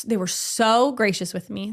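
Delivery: ordinary delivery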